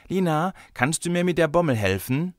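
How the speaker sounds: high, lightly voiced little boy; child asking. A narrator's reading. Boy asks his teacher for craft help, childlike.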